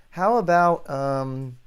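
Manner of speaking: ordinary talk, nothing marked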